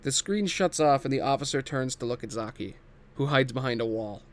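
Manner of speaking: normal talking voice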